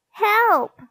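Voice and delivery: very high, loud squeaked cry; piercing little-boy yelp; Perfect Peter's squeak. A narrator's reading. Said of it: "squeaked Perfect Peter".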